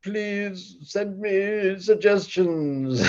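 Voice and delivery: high, slow, loud whining; over-the-top pleading falsetto; whiny plea. Pretend. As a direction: beg like a helpless drama queen. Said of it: "you gotta be like".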